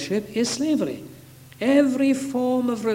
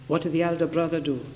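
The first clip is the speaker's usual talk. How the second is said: ordinary talk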